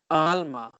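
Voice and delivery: "Alma" as AHL-mah, carefully; "Alma" with an open first a; careful attempt. Shown as a demonstration. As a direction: try the Spanish "Alma" as a learner. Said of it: "make sure I'm pronouncing it right".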